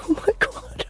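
breathless unvoiced whisper. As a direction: whisper it in shock. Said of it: "sitting there just going".

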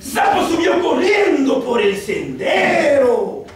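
loud, high, slow and expressive; expressive model reading. Shown as a demonstration. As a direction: read it aloud like it's alive, with energy. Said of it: "Lo tienen que hacer mejor que yo". (lectura expresiva, fuerte y aguda, lenta)

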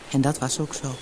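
ordinary delivery

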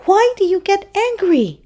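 high-pitched seahorse question; curious seahorse. A narrator's reading. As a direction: ask in a high little character voice.

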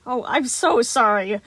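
slow, loud, high sorry; stammered apology. Acted. Animatronic stammering apology to the boy, slow and loud. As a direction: drag out a shaken apology. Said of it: "the animatronic stammered".

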